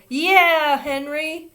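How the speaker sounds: high, loud teasing cheer; yelling. A narrator's reading. Big boys teasing Henry from a window, yelling. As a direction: tease him from the window. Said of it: "yelled some big boys".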